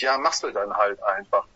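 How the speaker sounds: low, matter-of-fact shrug; resigned acceptance. Retold or imitated. Band member shrugging off a tour challenge, resigned acceptance. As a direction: drop the pitch and just accept it.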